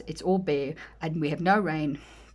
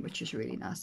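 soft aside; 8 semitones lower, 7 dB quieter than usual. Real, not acted.

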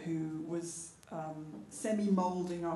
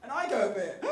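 usual speaking voice

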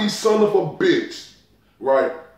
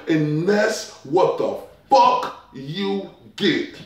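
slow catchphrase; slower than usual. Acted. Catchphrase drawn out.

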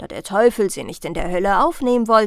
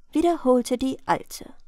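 ordinary delivery

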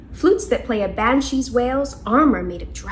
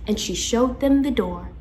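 usual speaking voice

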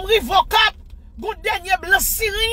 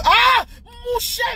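high drawn-out wail, slow and loud; wailing cry; 8 semitones higher, 3 dB louder, much slower than usual. Retold or imitated. Tells followers how she cried, wailing. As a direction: cry it out slowly like sobbing.

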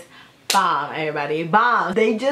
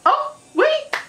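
shocked shriek; 11 semitones higher, much slower than usual. Real, not acted. Shocked at video scene.

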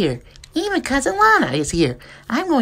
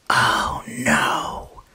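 a frightened whispered gasp of a villain; mock-scared villain whisper; whispered dismay; 13 semitones lower, 4 dB quieter than usual. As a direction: whisper it as the villain sees he's beaten. Villain sees Rocket Rabbit.

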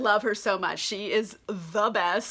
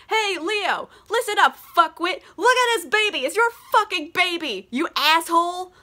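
high, loud mock tirade; Paulina laying into him; 8 semitones higher, 4 dB louder than usual. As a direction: tear into him in a raised, high-pitched retold rant. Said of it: "lays into this guy".

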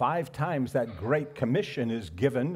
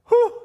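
excited whew; 11 dB louder than usual. Real, not acted. Exhale after praise.

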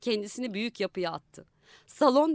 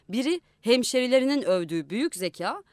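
ordinary delivery, nothing marked

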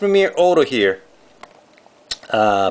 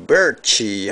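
ordinary talk

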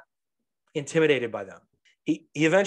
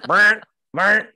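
loud buzzing fart noise; imitated farts; 6 semitones higher, 9 dB louder than usual. Dad imitating son's farts, loud buzzing noise. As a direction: mimic short loud farts with the mouth.

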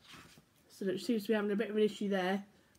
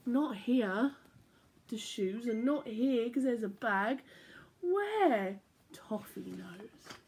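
bright, lilting storybook voice; reading to children; slower than usual. A narrator's reading. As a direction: read it like bedtime story time for little ones. Reading picture book aloud.

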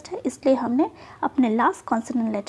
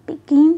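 Begin with गिन hit hard and loud; stress on second syllable; 8 dB louder than usual. Shown as a demonstration. Teacher shows students the stressed syllable in begin. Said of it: "गिन को".

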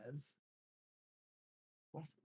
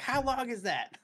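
daughter voice; 10 semitones higher than usual. Retold or imitated. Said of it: "She's like".

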